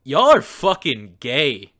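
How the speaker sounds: loud, drawn-out verdict; slow loud declaration. Acted. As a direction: declare it loudly, slowly.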